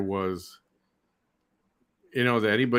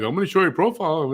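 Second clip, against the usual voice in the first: mocking threat; 8 semitones higher, 4 dB louder, faster than usual. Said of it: "she's like".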